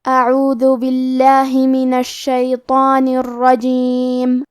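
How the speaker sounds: slow, melodic Quran chant; tajweed recitation. Shown as a demonstration. Teacher recites the verses for students to hear, melodic chant. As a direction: recite slowly, with full tajweed. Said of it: "تلاوت تجوید کے ساتھ سماعت فرمائیں".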